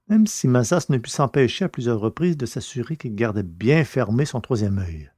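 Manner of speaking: plain reading voice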